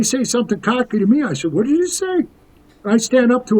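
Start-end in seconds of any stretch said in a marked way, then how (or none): 1.5-2.2 sharp, pitched-up challenge, daring him to repeat it; challenging retort. Retold or imitated. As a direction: snap back at a cocky kid. Confronting cocky youngsters at party.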